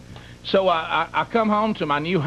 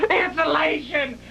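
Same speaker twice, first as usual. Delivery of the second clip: high slow crying wail; child screaming; 13 semitones higher, slower than usual. Retold or imitated. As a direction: wail it like a scared little boy. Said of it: "he starts screaming".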